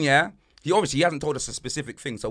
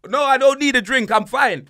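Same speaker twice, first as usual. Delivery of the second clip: mock Abraham; 8 semitones higher, 6 dB louder than usual. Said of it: "And Abraham goes".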